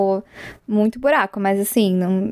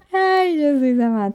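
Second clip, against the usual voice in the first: laughing; 4 semitones higher than usual. Real, not acted. Recovering from laughing fit.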